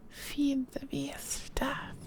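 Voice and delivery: slow eerie whisper. Pretend. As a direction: hiss it out slowly, barely audible. Whispered game chant.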